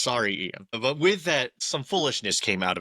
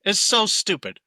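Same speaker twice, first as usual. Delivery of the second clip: a much higher, louder outburst of disgust; exasperated; 10 semitones higher, 6 dB louder than usual. Real, not acted. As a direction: let the frustration burst out, loud and high.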